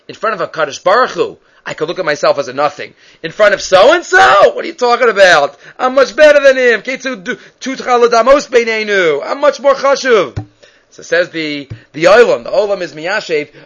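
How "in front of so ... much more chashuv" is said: high, loud, scornful outburst, acted out; indignant boasting. Honored man dismissing so-and-so as beneath him, indignant shouting. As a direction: burst out offended, as if insulted by the comparison.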